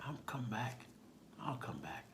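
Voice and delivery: hushed, dropping reassurance; quiet reassurance. Retold or imitated. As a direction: answer a child gently and softly. Officer reassuring the boy.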